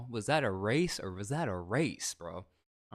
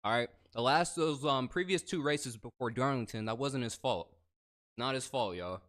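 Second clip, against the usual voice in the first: normal talking voice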